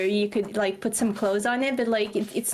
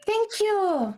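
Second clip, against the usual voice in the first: delighted gasp; 9 semitones higher than usual. Real, not acted.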